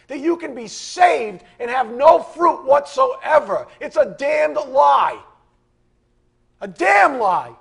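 loud, high furious preaching; shouting in real anger at the congregation; angry shouting. Real, not acted. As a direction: rail against the lie at full voice. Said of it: "Now I'm getting mad".

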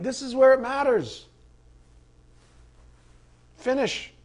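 Paul urging the Corinthians like a track coach. Pretend. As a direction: spur on a runner who is fading.

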